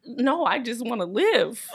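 ordinary talk, nothing marked

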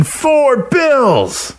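high, excited yell of a win; cheering a big win with a chest bump; yell in unison. A narrator's reading. Two friends yelling in unison at the track, high and excited. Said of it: "yelling in unison".

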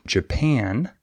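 Japan with the second syllable stressed, ja-PAN. Shown as a demonstration. Teacher modelling the word for learners, stress on second syllable of Japan. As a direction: hit the second half of Japan. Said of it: "the second syllable is stressed".

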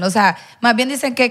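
ordinary delivery

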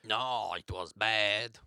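slow, low French mime; French accent. Acted. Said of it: "my French accent isn't that good".

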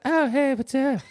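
high, chill dog voice; dog's voice. Pretend. Speaking for his dog Tony, high laid-back dude voice. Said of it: "Tony's like".

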